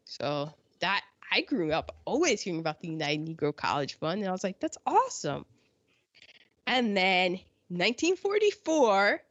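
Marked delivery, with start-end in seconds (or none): 4.6-5.4 high, mildly mocking cheer; retold enthusiasm. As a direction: pitch it up in naive approval. Retold reaction as a child.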